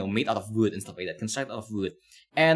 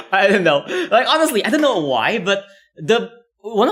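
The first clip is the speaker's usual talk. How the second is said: laughing; 9 semitones higher, 8 dB louder than usual. Real, not acted. Laughing while admitting a habit.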